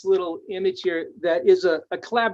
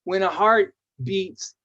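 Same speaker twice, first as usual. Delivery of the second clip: ordinary talk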